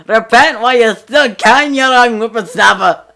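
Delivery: high, loud preacher-like yell; old man yelling. Acted. Old man shouting at the teens, loud ranting yell. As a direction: bellow it like a crazed old street prophet. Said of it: "starts yelling".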